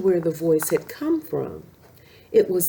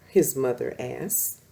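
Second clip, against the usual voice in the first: ordinary delivery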